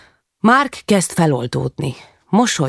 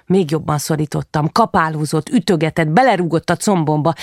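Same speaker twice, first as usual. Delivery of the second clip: ordinary delivery, nothing marked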